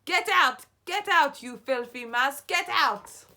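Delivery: high, loud repeated yell; teacher on a desk shouting at mice. A narrator's reading. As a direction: chase them off with your voice. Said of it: "stand upon desks and shout".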